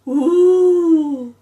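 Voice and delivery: long loud high ghostly moan; ghost wail. Acted. Claire imitating the ghost, loud ghostly ooh. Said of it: "and it went".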